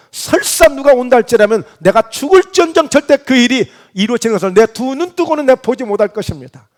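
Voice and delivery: forceful, rousing pledge of loyalty; confident vow. Acted. Peter vows to protect Jesus with his life, boldly assured. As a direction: declare it boldly, surging on the key promises. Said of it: "이렇게 장담했던 사람이에요".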